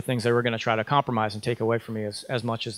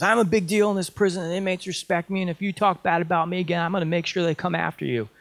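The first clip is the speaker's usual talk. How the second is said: higher, mock-menacing imitation of the counselor; retold threat; 6 semitones higher than usual. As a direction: imitate her threatening, pitched up. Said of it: "She started threatening me with violence".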